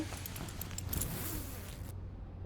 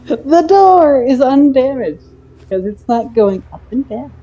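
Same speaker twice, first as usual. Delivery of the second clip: loud, high, slow discovery; slow excited realization; 6 semitones higher, 7 dB louder, slower than usual. Real, not acted. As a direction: spell out the realization in awe. Realizes the puzzle solution.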